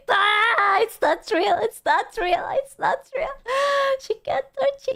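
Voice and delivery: high, frantic it's not real; mock panic.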